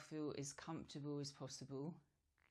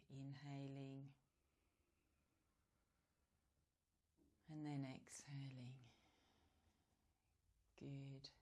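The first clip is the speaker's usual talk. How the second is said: guided meditation. Real, not acted. Yoga teacher guiding relaxed breathing, calm meditation voice. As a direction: ease them gently into stillness, leaving space between phrases.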